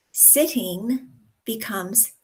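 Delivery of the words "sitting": SITTING with the final ng fully sounded; careful, complete ending on Sitting; full ING ending. Shown as a demonstration. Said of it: "dropping the ends of words".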